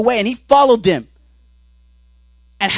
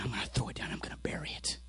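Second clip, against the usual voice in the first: whispered, barely voiced vow; hushed resolve; 10 semitones lower, 21 dB quieter, faster than usual. Peter resolving to bury his sword, hushed resolve. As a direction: vow it under the breath, very soft.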